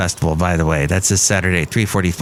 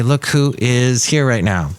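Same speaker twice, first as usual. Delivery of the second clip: usual speaking voice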